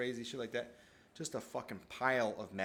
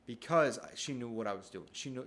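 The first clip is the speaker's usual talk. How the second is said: usual speaking voice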